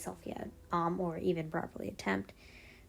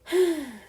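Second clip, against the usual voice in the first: loud, falling sigh; heavy sigh; 9 semitones higher, 6 dB louder than usual. Real, not acted. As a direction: let out a big sigh. Sighs before giving the backstory.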